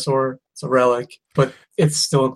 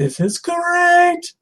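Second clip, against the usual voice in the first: high, slow, thrilled squeal; hammed-up teenage delight; much slower than usual. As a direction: drag it out in wide-eyed glee. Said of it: "me being a teenager in the nineties going".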